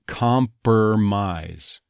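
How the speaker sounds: "compromise" slowly as com-per-mise; reversed R "compromise". Shown as a demonstration. Coach breaks down the word, slow com-per-mise. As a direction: move the r after the vowel, "compromise" as comp-er-mise. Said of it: "reversal of where the R is".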